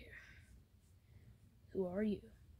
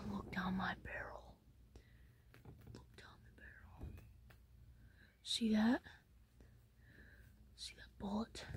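menacing whisper; 9 dB quieter than usual. Acted. Threatening with pistol.

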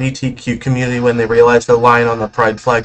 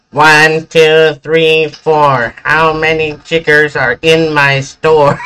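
higher, nasal meme impression; raised nasal sing-out; 5 semitones higher, 4 dB louder, slower than usual. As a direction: mimic the meme in a nasal voice, pitched up. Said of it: "like, a nasal tone voice".

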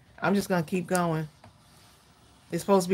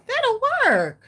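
high, delighted exclamation, much higher pitch; happy; 4 dB louder than usual. Real, not acted. Crafter relieved the piece fits, happy. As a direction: sing out pleased relief in a high voice. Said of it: "You sound so happy".

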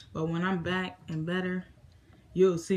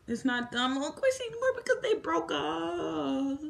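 mock wail; 9 semitones higher than usual. Vlogger lamenting a couple's breakup, mock wail. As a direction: let it climb into a long high wail.

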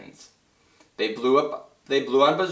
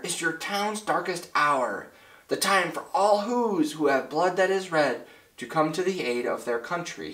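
put-on rally the boy with solemn grandeur; dramatic mayor; 7 semitones higher than usual. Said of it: "cried the mayor".

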